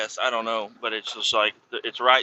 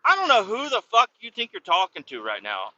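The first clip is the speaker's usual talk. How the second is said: higher-pitched indignant retort; irritated comeback; 6 semitones higher than usual. Retold or imitated. Driver answering the kid who talked back, indignant. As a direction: put someone in their place, retold with irritation. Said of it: "It got me real irritated".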